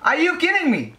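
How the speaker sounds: high disbelieving squeal at the game; real outrage, voice jumps up; shocked outrage. Real, not acted. Game frustration.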